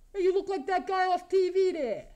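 ordinary talk, nothing marked

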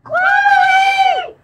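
very high, loud shout; shouted call. Real, not acted. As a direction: yell her name to get her attention. Calling out to Chloe.

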